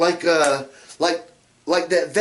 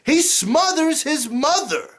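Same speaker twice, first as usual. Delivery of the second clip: gleeful; 9 semitones higher, slower than usual. Real, not acted. Relishing the irony.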